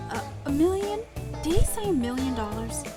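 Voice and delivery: high, loud stammered shock; shocked disbelief. Acted. Heroine hears the suit's price, shocked and high.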